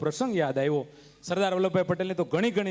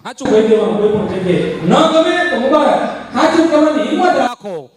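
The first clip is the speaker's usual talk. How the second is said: thundering shout; 5 semitones higher, 14 dB louder than usual. Acted. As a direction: roar the motto at full force. Booming Sardar motto to audience.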